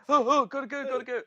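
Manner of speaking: high-pitched mock fluster; regular panic, acted. Co-host acting how anyone else would flee, mock panic. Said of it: "It would have been like".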